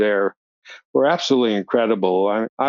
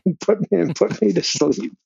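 laughing through the words, pitch jumping high; 8 semitones higher than usual. Real, not acted. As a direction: chuckle it out, voice going up. Laughing while telling the milk story.

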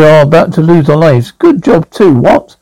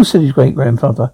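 ordinary delivery, nothing marked

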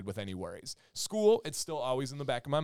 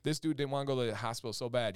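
normal talking voice

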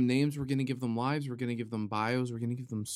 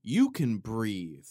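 YOU can breathe, slow and firm; declaration statement; 5 dB louder, much slower than usual. Shown as a demonstration. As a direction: lay it down slowly as a decree. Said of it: "that's a declaration statement".

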